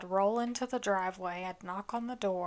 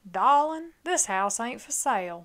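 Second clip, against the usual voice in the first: lightly put-on Charleston accent; 2 semitones higher, 6 dB louder than usual. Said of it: "that was my Charleston accent".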